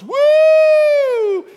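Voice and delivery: long, high, loud woo; gleeful child's whoop. Retold or imitated. Son riding back and forth in the driveway, gleeful whoop. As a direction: whoop like a kid coasting on a bike. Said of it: "and just goes".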